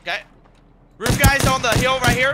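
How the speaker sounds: loud, high urgent callout. Real, not acted. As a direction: warn your teammate fast and loud. Callout under fire.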